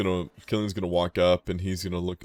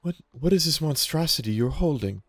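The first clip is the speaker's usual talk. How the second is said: high, loud voice of an interrupted deity; indignant goddess cutting a prayer short; Titania; 8 semitones higher, 6 dB louder than usual. Acted. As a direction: demand an answer, raised and higher-pitched. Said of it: "kind of abruptly stops and goes".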